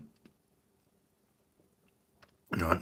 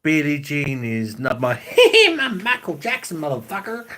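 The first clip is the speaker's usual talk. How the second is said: high mock-singing that leaps into a falsetto yelp; 7 semitones higher, 8 dB louder than usual. Ridiculing singers who name themselves, shrieky high sung impression. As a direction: parody a pop star breaking off to shout out his own name. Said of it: "You don't get [name] going".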